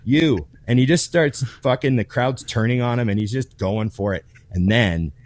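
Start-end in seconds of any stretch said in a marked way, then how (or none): 0.1-0.5 fast, louder and higher defiant taunt; mock taunting the crowd. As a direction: dare the crowd to throw him out. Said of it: "He's like".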